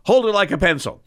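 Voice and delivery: high-pitched, exasperated surgeon's order; retold yelling. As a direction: imitate a boss losing patience with a trainee. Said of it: "he yells at me".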